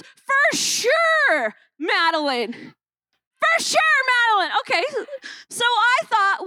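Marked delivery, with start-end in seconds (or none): 0.0-4.6 mock confident crowd, building. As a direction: ham up their certainty, rising higher. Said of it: "It was".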